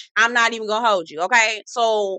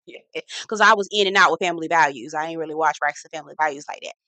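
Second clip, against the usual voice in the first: usual speaking voice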